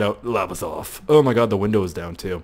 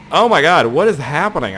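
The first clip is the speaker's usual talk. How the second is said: loud, high burst of disbelief; startled exclamation; 6 semitones higher, 7 dB louder than usual. Real, not acted. Gamer reacting to spinning out, loud startled exclamation. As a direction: cry out as things go haywire.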